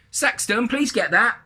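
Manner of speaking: high, loud mum's call; calling from upstairs. A narrator's reading. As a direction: call down to your child from upstairs. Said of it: "exclaimed from upstairs".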